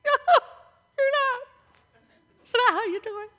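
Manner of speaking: high, soft, strangled protest; hammed-up whimper of disbelief at the cooks; mock dismay. Retold protest to barbecue cooks.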